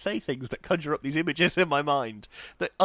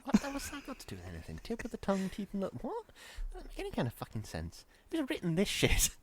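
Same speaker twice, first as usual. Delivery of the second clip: baffled reader; 6 semitones higher, 9 dB quieter than usual. Retold or imitated. Acting baffled Guardian reader.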